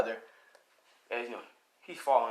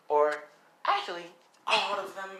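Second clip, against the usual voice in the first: lightly voiced villain, pitched up; higher, relishing the threat; gleeful Gremlin villain; 7 semitones higher than usual. Gremlin deciding to throw every pumpkin, gleeful villain.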